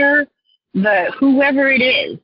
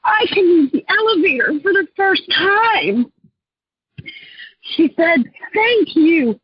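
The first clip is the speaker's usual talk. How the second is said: moved, higher-pitched voice; real emotion; 6 semitones higher than usual. Real, not acted. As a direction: retell it while choking up for her. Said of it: "I'm getting emotional about that".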